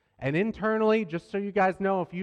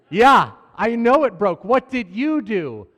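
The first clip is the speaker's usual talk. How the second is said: louder, slow pressing question; frustrated dad; 4 semitones higher, 10 dB louder, slower than usual. Retold or imitated. Dad pressing his daughter about the dresser, louder frustrated questioning. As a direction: lean in hard on the child for an answer.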